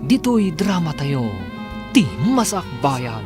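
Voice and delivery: normal talking voice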